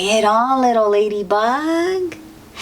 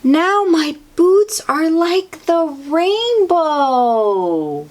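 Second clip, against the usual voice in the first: ladybug voice; 8 semitones higher, slower than usual. Acted. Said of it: "little ladybug said".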